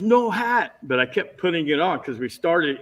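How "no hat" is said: high-pitched imitation of the director; director's objection. Director stopping the actor over his hat, high imitation. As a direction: put on the director's high, insistent voice. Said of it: "he goes".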